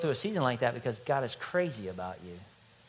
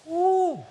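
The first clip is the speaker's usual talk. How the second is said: excited woo; 4 dB louder than usual. Real, not acted. Preacher's cheer before the word.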